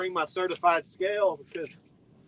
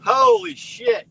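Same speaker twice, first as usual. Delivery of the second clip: loud high stunned outburst; amazed exclamation; 13 semitones higher, 7 dB louder, slower than usual. Real, not acted. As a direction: blurt it out in shock. Amazed at another big fish.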